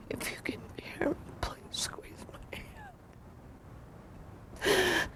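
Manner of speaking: soft pleading whisper; whispered plea. Retold or imitated. Wife whispering to her dying husband, pleading whisper.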